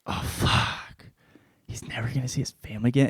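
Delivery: hushed, breathless whisper, rushing; imitated captive's hushed despair. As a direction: whisper it in dread, a little fast.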